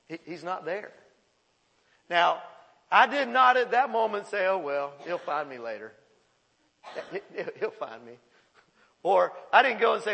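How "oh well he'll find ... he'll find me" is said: low, offhand shrug of a line; mock nonchalance. Dad pretending he'd shrug off a lost son, mock nonchalance. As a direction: play it casual and unbothered, lower.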